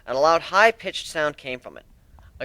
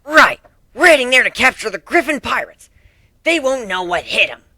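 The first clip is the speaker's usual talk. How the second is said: loud, much higher cocky boast; cocky Dash; 11 semitones higher, 9 dB louder than usual. Acted. Rainbow Dash boasting about the pirates, loud and cocky. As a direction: brag about beating pirates. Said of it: "Dash explained".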